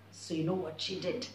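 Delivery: soft low teaser; hushed suspense. A narrator's reading. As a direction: lean in, secret. Teasing question to kids.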